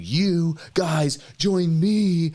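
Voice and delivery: mock hype. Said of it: "He's saying".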